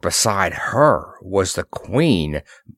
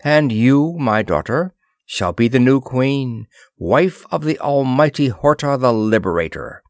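ordinary delivery, nothing marked

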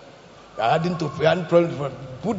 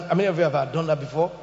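ordinary talk, nothing marked

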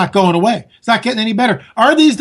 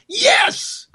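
a very high, delighted cry of yes; excited cheer; 3 dB quieter than usual. Real, not acted. Host cheers Cruz's plan to abolish the IRS, very high and delighted. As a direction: cheer it like a fan.

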